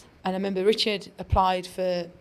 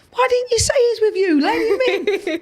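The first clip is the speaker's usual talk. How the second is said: high, loud, laughing turnaround; the landlord suddenly starstruck, much higher and louder; excited landlord; 14 semitones higher, 8 dB louder than usual. Retold or imitated. Landlord recognising her at the pub door, high and loud excited.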